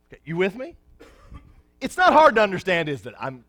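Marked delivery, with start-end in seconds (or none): 1.8-3.1 louder, pointed rhetorical push on hard; exaggerated for emphasis. Shown as a demonstration. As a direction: lean hard on it to make the point. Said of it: "I'm exaggerating for emphasis".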